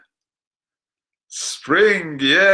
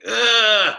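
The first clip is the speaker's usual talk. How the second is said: angry grunt; 9 semitones higher, 6 dB louder than usual. Shown as a demonstration.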